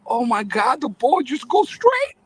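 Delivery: high-pitched, drawn-out retold astonishment; slow, rising mock-incredulous marvel; amazed kid. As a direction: relive a boy's wonder, voice climbing. Boy amazed by real field.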